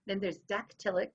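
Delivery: ordinary talk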